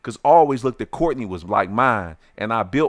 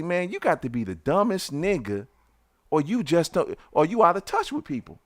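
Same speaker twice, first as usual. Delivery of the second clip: higher, heated voice; retold angry insult; 7 semitones higher than usual. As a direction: lash out at him. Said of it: "I say".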